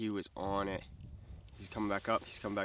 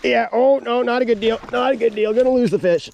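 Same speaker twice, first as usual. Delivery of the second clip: alarmed; 9 semitones higher, 7 dB louder than usual. Real, not acted. Fish tangled, panicking.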